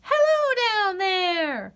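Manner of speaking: high, slow squirrel voice calling down. Acted. Squirrel calls down to the duck and goose, high and slow. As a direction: call down from a branch. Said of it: "called a squirrel".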